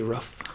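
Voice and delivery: "rough" with a short uh, meaning roof; regional "roof". Shown as a demonstration. Showing how they say roof, short-vowelled "rough". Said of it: "Instead of roof, they say rough".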